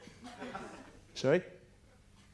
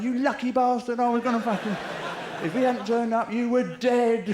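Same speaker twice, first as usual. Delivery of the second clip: blustering hooligan; 8 semitones higher, 3 dB louder than usual. Acted. Hooligans boast once copper arrives.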